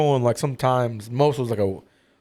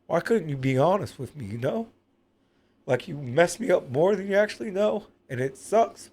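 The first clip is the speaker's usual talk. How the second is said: hurt, tearful and higher-pitched; real pain; 5 semitones higher, 3 dB quieter than usual. Real, not acted. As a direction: let the pain show as you ask. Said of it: "the pain is very real, as you can see".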